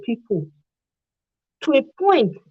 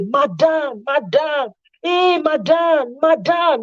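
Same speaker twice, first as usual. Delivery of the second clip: high, slow, drawn-out calls of madam; fawning madam calls; 5 semitones higher, much slower than usual. Retold or imitated. Calling the man's wife sweetly in fawning praise.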